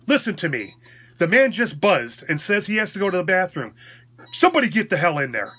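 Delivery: retold angry order to the nurses; angry demand. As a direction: re-enact a loud, indignant demand.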